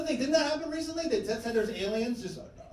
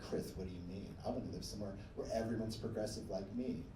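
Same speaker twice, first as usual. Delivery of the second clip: much lower, quieter mock voice; muttered liberal caricature; 11 semitones lower, 12 dB quieter, faster than usual. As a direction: drop low and soft to mimic them. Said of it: "People are like".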